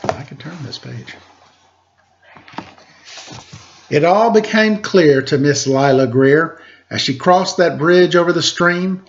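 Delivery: lilting rhymed read-aloud voice; story narration. Acted. Host reading a rhyming picture book to kids, lilting narration. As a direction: read the verse to the children with bounce.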